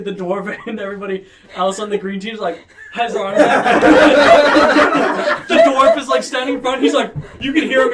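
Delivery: narrating through loud laughter; can barely get the words out for laughing; real laughter spilling into the narration. Real, not acted.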